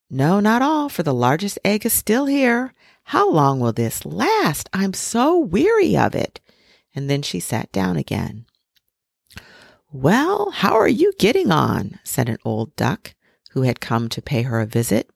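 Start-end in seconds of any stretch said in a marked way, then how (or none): 0.1-6.4 lightly voiced mother duck, higher and a touch weary. A narrator's reading. Mother duck complains of the unhatched egg, mildly weary. 9.9-12.0 higher, lightly voiced old duck; a nosy old neighbour dropping by; old duck visitor. A narrator's reading. Old duck visits the sitting mother, lightly voiced.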